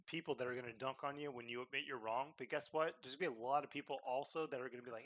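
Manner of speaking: usual speaking voice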